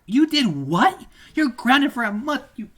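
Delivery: high, loud mock-outraged mom. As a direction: shriek it as an over-the-top Karen. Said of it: "best stereotypical woman voice".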